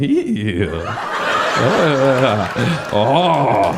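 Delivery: giggly drawn-out stoned noises, semi-sung; a mock opera of giggles; laughing opera. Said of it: "we're like a fucking opera".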